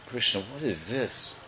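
hushed question. Acted. Devotee to Krishna about the attack, hushed question. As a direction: drop right down to ask it. Bewildered quote, much softer.

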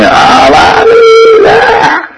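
mock child wailing rising very high; imitated anguished wail; child's real crying. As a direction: cry out like a stung baby. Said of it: "he's crying".

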